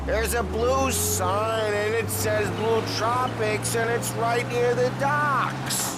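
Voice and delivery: put-on high, slow drunken directions. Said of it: "you're drunk".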